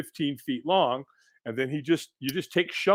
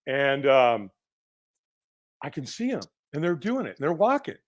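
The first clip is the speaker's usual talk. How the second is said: usual speaking voice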